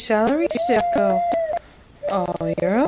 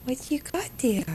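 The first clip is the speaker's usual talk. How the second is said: whispered question; 2 semitones higher, 6 dB quieter than usual. Real, not acted. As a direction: breathe it gently, no voice. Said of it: "repeated as whisper".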